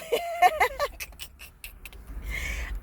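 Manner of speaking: long high laugh that nearly turns to crying; giggling fit at her own shyness; high wheezing laugh. Real, not acted. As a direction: laugh until it squeaks. Laughs at being shy on camera.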